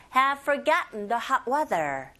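slow, measured English phrase; slow pronunciation model. Shown as a demonstration. Teacher leads repeat-after-me practice, slowly. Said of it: "따라해보세요".

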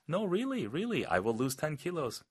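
high-pitched pleading to be believed; insistent protest. Acted. Acting the insistent dieter's protest.

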